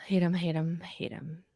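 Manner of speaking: whispered repeat. Real, not acted. Host muttering her hatred of Louis in a whisper. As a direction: hiss it under your breath.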